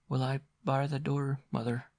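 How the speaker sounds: a hint of a timid girl; girl asking her mother; Nell asking. A narrator's reading. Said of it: "asked Nell".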